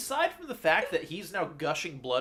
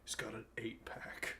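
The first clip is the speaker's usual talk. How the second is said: whispered aside; 5 semitones lower, 15 dB quieter than usual. Real, not acted. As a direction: whisper it behind a hand.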